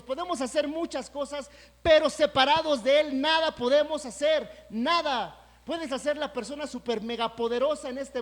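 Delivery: loud, high, driving sermon peak; preaching at full force. Acted. Preacher declaring to the congregation that without Him they have nothing, at full force. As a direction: hammer it home to the church.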